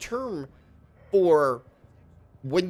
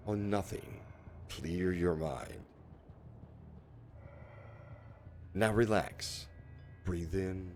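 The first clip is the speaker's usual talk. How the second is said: low, soft, slow guided calm; mock ASMR meditation; 6 semitones lower, 10 dB quieter, slower than usual. Streamer jokingly guiding chat through meditation, low and soft. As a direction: talk them into calm, hushed and slow. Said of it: "What do you think about that A S M R".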